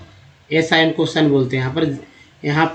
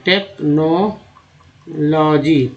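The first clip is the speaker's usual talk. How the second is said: Technology very slowly, as take-no-logy; syllable by syllable; much slower than usual. Shown as a demonstration. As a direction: stretch and separate each part of technology. Teacher splits word into parts. (Technology को बहुत धीरे, तोड़-तोड़कर)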